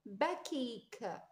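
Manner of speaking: "باكيك" slow, ending on a released k; k sound. Shown as a demonstration. Teacher modelling the k sound, "باكيك" slow with final k. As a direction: say "باكيك" slowly, sounding the last k. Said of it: "ك ساوند".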